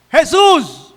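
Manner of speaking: high, loud call for help; desperate cry. Acted. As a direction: shout out past those hushing you. A gritar desgarradoramente.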